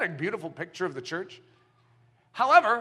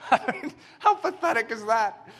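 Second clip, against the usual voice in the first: laughing. Real, not acted. Laughing at the sloth.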